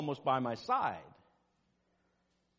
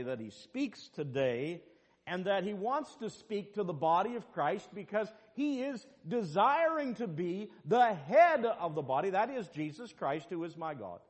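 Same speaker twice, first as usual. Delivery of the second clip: preaching; 5 semitones higher than usual. Acted. Pastor preaching to the congregation, rising in force. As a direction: build the line up to the pulpit.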